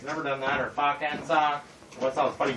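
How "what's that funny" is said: ordinary talk, nothing marked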